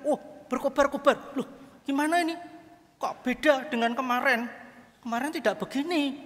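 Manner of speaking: high-pitched bewildered dismay; mock alarm. Mukidi sees hell has changed, high bewildered protest. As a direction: act taken aback, pitch jumping up.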